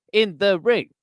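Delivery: stop-start sportscaster cadence with long pauses; every word gets its own swooping inflection; Howard Cosell. Shown as a demonstration. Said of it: "You kinda have to do that with Howard".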